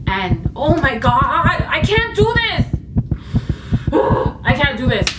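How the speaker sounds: really rattled, can't get the words out; real panic rising in the voice; overwhelmed. Real, not acted. Overwhelmed again mid-story.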